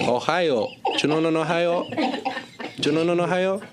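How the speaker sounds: high mock Belgian-Cuban accent, laughing at the end; sing-song Belgian-Cuban accent, playing; hammed-up Belgian-Cuban accent. Son teasing his father, hammed-up Belgian-Cuban accent. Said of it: "Belgian-Cuban person".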